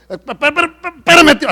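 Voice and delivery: high, loud flustered stutter; tartamudeo. Retold or imitated. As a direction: stammer as if too worked up to talk. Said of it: "hasta tartamudeé". (tartamudeando alterado, actuado)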